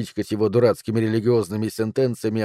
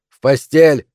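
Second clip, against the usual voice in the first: loud, higher barked order; yelling; 4 semitones higher, 9 dB louder, slower than usual. A narrator's reading. Father ordering his son to bed, yelling. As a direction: bellow the command. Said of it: "заорал он".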